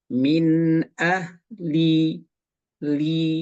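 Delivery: "Min ahli" very slow, the "li" vowel narrowed; narrowed kasrah. Shown as a demonstration. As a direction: squeeze the i of "li" thin and tight. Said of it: "sempitkan suaranya". ("Min ahli" dengan kasrah disempitkan)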